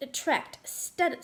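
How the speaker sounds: usual speaking voice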